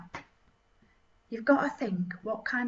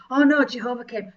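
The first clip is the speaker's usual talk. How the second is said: ordinary talk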